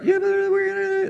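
high squeaky whine; a high, squeaky mock whine of protest; whiny protest. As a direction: whine like a scared crowd. Mocking the crying people.

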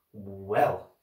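low, drawn-out hesitation; tentative low opener; hesitant. A narrator's reading. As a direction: begin reluctantly, stretching the word. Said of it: "Klaus said hesitantly".

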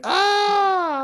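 very high mimicked shriek; drawn-out high mock scream; imitated scream. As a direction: imitate a game character screaming. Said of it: "You know when she screams".

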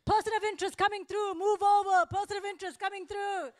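high, loud mock announcement to clear the way; imitated shouting. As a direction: call out like an officer clearing a path. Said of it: "The whole time he was shouting".

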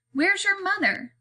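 high-pitched, puzzled question. A narrator's reading. Aunt asks where the girl's mother is, puzzled. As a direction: ask it high, with a swooping pitch.

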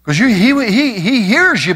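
ordinary delivery

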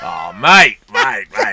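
hammed-up Aussie accent; loud, teasing put-on Australian. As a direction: lay the Australian accent on thick. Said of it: "Please no more Australian accent".

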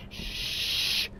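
soft unvoiced shush. Real, not acted. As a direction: hush it sharply. Shushing in scary game.

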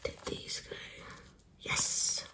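soft unvoiced whisper to herself. Real, not acted. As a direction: breathe the words, just for yourself. Quietly talking to herself while crafting.

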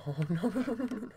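soft whimper rising in pitch; whimpering dread. Real, not acted. Player dreading the next scare, whimpering. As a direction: whimper a trembling run of refusals.

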